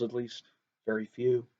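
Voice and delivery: dropping to a soft, low aside; quiet aside. A narrator's reading. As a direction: tuck it away quietly, low. Narrator's wry afterthought.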